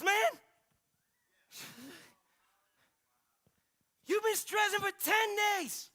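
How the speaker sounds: gangster-style incredulous telling-off; indignant Holy Spirit. Retold or imitated. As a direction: confront him in loud disbelief. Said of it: "he stood like a gangster beside me".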